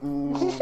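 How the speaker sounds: high pained ooh; mock wince. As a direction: wince at the sharp rock. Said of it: "You're like".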